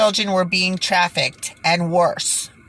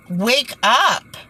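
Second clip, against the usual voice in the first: raised, higher "wake up" to the audience; urgent call; 6 semitones higher than usual. Real, not acted. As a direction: shake them awake with your voice. Urging viewers, raised voice.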